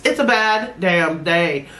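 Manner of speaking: usual speaking voice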